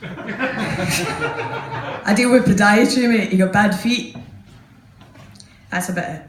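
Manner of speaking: ordinary talk, nothing marked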